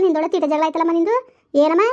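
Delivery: ordinary talk, nothing marked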